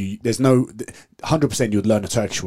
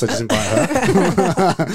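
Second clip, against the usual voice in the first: slow words through a laugh; 8 semitones higher, 4 dB louder, much slower than usual. Real, not acted. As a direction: laugh it out slowly. Joking while laughing.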